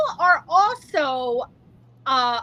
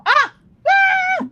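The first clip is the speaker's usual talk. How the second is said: high excited squeal of delight; delighted shriek; 6 dB louder than usual. Real, not acted. Host squealing at the endorsement news, delighted shriek. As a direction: shriek with disbelieving joy.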